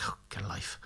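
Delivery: very soft, under the breath; muttered exasperation. Acted. Son scoffs at Dad's repeat visits, muttered. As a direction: mutter it aside, barely voiced.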